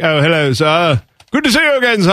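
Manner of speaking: polite mock-English greeting, swooping high; hammed-up English gentleman accent; put-on English accent. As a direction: greet them in a playful fake English accent. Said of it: "I would be adopting that".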